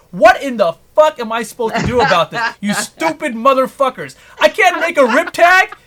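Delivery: building from a rant to a high, loud yell; angry rant. Real, not acted. Creator ranting at YouTube over flagged videos, furious yell.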